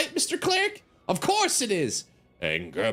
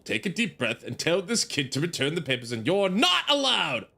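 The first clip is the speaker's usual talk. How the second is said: ordinary talk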